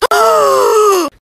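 high, drawn-out mock gasp; sudden realization gasp. Shown as a demonstration. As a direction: gasp as if it just hit you. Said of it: "It goes like this".

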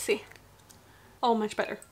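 wearing clear dental trays; speaking through teeth trays; with aligners in. Real, not acted. Seen 1: Inserts aligner at -1.0, then talks with trays on her teeth.

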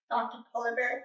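usual speaking voice

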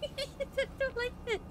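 very high, slow scared whine; tiny squeal of dread; frightened whimper. Real, not acted. Scared by horror game.

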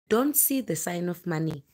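usual speaking voice

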